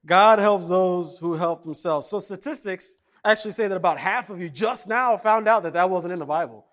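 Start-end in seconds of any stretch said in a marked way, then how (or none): none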